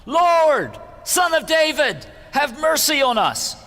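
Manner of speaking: high, loud desperate cry for mercy; shouting for mercy. A narrator's reading. Blind men crying out to Jesus by the road, loud pleading shout. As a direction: call out over the crowd, pleading. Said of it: "they began shouting".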